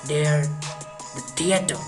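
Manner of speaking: ordinary delivery, nothing marked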